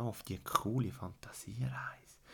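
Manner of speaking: soft excited whisper. Acted. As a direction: whisper it like a secret. Inviting the hero on the journey.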